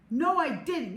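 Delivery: high loud no; flat childish denial; squabbling. A narrator's reading. Max argues with Chloe, high loud denial. Quarrel between kids.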